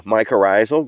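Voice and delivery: ordinary talk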